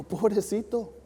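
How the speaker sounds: sad pity. Retold or imitated. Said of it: "mi esposa se puso bien triste".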